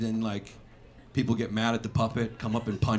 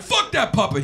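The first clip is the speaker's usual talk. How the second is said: angry heckler; 9 semitones higher, 5 dB louder than usual. Retold or imitated. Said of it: "Everyone's like".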